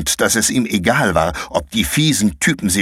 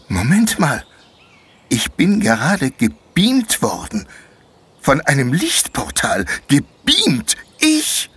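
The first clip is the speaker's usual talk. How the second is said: put-on higher, amazed young boy voice; a boy baffled at being beamed away; lightly voiced boy marvelling; boy Konrad; 8 semitones higher, slower than usual.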